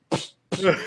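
soft unvoiced ps of dead air; imitated mic hiss. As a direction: hiss like an open silent channel. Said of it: "tú escuchabas el ps".

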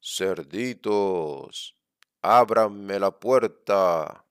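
slow, loud drawn-out wolf howl. Acted. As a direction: howl the words at the door. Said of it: "aulló así".

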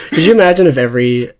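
ordinary delivery

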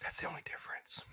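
a pointed whispered aside. Real, not acted. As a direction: lean in and whisper the punchline. Pointed aside after rant.

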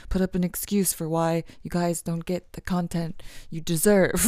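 usual speaking voice

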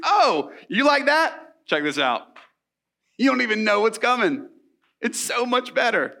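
hammed-up cocky tease; mock taunting. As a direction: rub it in playfully, like a show-off. Jesus taunting the Pharisees.

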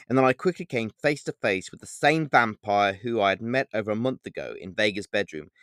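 usual speaking voice

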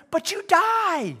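high, slow, drawn-out warning; emphatic warning. Acted. Jesus counters foolishness, high emphatic warning. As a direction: stretch the last word high, then let it fall.

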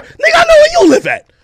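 strained, cracking yell; furious shout. Real, not acted. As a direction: voice cracking high with rage. Furious threat at [name].

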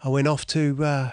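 ordinary talk, nothing marked

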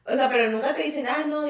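ordinary talk, nothing marked

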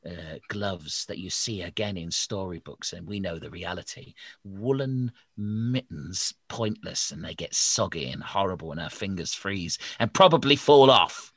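ordinary delivery

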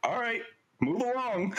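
imitated singsong send-off; mock dismissal. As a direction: shoo someone away, retold for laughs. Retelling Nick brushing off the lady.

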